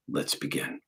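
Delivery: ordinary talk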